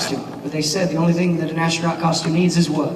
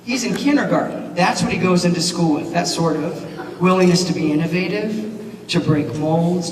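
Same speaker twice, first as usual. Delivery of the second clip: ordinary talk, nothing marked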